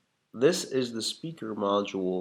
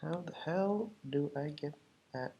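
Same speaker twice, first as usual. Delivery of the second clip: whispered to himself; whispering; 3 semitones higher, 9 dB quieter than usual. Real, not acted. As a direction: whisper in puzzled frustration. Muttering to himself while working.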